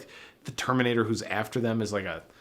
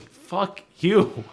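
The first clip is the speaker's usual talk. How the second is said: loud, high mock fury; hammed-up angry outburst; exasperated outburst; 9 semitones higher, 6 dB louder than usual. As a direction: curse it out at an annoying screen. Acting out fury at pop-up.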